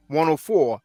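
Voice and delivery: usual speaking voice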